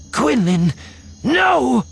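high, loud cry of alarm; desperate shout. Acted. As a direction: scream his name in panic. Ventress cries out in alarm.

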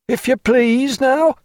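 high pleading sailor; sailor urging. A narrator's reading. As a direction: press the sleeper to wake up. Sailor keeps calling him.

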